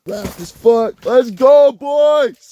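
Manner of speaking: frat bro hype. Real, not acted. Celebrating a catch.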